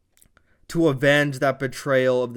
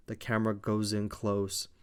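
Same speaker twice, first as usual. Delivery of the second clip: ordinary talk, nothing marked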